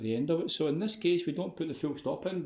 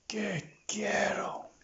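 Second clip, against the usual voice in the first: whispered praise; 8 semitones higher than usual. Real, not acted. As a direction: hush the praise to a calm dog. Praising the dog quietly.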